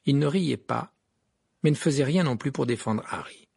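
plain reading voice

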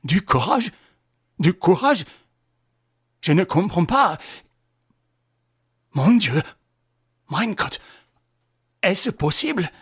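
a doomed man clutching at words; terrified stammer. Acted. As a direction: Du courage? stammered in rising panic. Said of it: "pâle comme un mort".

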